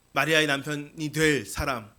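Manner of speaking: ordinary talk, nothing marked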